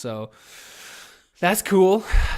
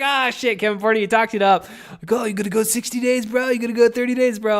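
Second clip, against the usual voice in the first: higher and louder, teasing himself like a bro; mocking bro voice; 9 semitones higher, 7 dB louder than usual. Host mocking his own sobriety boasts with a higher, louder bro taunt.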